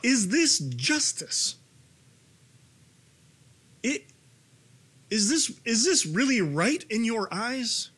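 grieving parents crying out to God; anguished cry. Acted. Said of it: "As they cry out".

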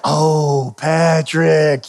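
drawn-out, let-down sigh of a name; frustrated groan. Retold or imitated. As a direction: stretch both words in mock dismay. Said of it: "And I get frustrated".

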